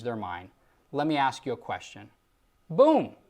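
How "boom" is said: ordinary talk, nothing marked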